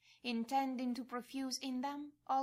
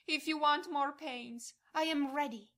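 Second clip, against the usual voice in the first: a loud, raised plea to all hearts; loud pleading cry; 2 semitones higher, 6 dB louder than usual. Acted. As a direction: beg loudly not to be left. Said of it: "And you cry out loudly to all hearts".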